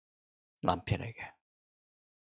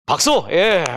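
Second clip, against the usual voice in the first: call for applause; 4 semitones higher, 8 dB louder, slower than usual. Real, not acted. Calling audience to clap.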